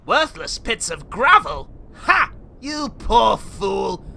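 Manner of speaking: loud, mocking, slow scorn; scornful laugh.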